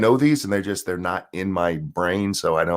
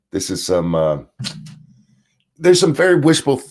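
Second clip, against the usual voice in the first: usual speaking voice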